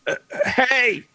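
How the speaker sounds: high, loud call of hey; character's shout. Acted. Briggs shouts to the others while looking for tracks, shouting. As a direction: shout out to get attention. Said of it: "he's gonna shout".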